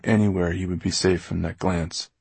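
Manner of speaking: ordinary delivery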